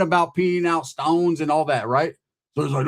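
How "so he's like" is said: normal talking voice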